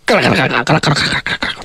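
fast, low rattling imitation of spinning wheels; quick low clattering wheel noise. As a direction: rattle it off like wheels racing away. Imitating the wind-fire wheels rolling.

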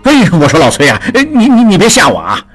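a nervous man tripping over his words; jumpy alarm. Acted. Frightened investor begging his partner, stammering and jumpy. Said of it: "一惊一乍地说".

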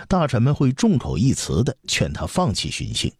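usual speaking voice

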